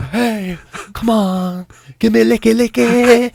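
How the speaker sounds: chocolate coaxing from the drawer, slow and soft; sly chocolate voice. Acted. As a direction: lure him with a drawn-out come on. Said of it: "I can hear the chocolate in the drawer".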